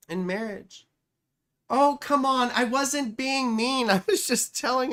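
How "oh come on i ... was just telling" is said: higher, pleading oh come on; high protest. Real, not acted. To chat after being called mean, a raised whiny protest. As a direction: defend yourself, voice rising in a whiny protest.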